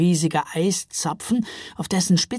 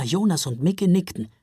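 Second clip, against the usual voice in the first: plain reading voice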